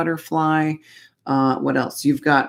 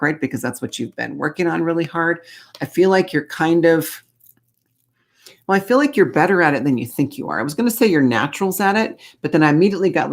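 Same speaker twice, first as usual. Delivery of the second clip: normal talking voice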